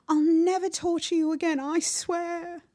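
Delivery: high, loud wailing promise; desperate vow. A narrator's reading. Bully begging his departing sister, desperate wail. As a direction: swear it in frantic pleading. Trapped boy begs and confesses.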